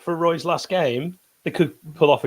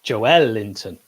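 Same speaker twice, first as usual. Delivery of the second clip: Joelinton leaning hard on ELL; stress on middle; 4 semitones lower, much slower than usual. Shown as a demonstration. Pundit corrects his co-host, Joelinton stressed on ELL. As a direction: hit the middle of Joelinton, Jo-ELL-inton, then drop. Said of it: "a real stress on the middle".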